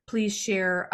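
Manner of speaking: ordinary delivery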